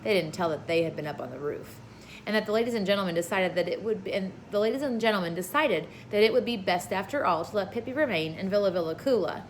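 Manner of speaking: plain reading voice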